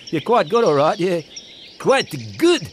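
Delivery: high, grudging sniff; sniffy. Acted. Pierre the pelican grudgingly praising Barney, sniffy. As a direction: concede it with a haughty sniff. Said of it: "sniffed Pierre".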